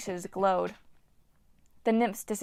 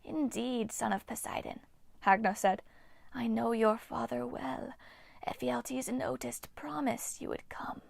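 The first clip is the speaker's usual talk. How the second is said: eerie breathy whisper of an ancient nymph; ghostly nymph whisper; 3 semitones higher, 6 dB quieter than usual. Acted. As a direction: hiss it like a withered water spirit. Nymph Hagno speaks.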